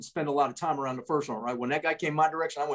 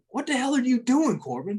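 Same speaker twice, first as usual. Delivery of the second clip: a loud, high call of disbelief that drops at the name; incredulous shout; 8 semitones higher, 4 dB louder than usual. Retold or imitated. Calling out to Corbin over the kid in the dumpster, an incredulous loud shout. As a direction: yell across the drop zone in disbelief.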